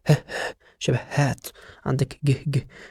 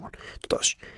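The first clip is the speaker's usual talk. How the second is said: whisper; 8 dB quieter than usual. Shown as a demonstration. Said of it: "whispered aside".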